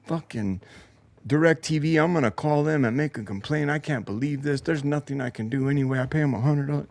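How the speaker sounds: exasperated outburst against DirecTV; losing it. Retold or imitated. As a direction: rant in disbelief at the TV service. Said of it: "So I start losing my shit".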